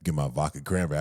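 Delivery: low, casual big bro; lightly voiced big brother. Imitating big bro playing it cool, low voice.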